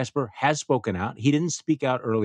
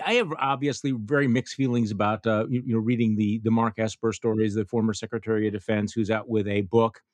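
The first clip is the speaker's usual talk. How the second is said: ordinary delivery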